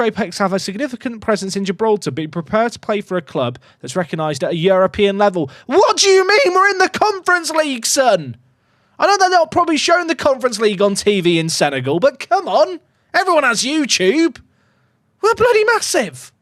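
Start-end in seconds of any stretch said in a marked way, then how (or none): 5.7-16.3 much higher, louder indignant rant; mock outraged shout. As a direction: rant in mock disbelief at the snub. Mock outrage at refusing player.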